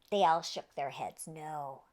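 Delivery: plain reading voice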